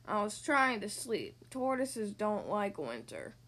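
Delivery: low, slow grumpy tortoise; grumbling. A narrator's reading. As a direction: grumble slowly, wanting to be left to sleep. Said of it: "grumbled Tortoise".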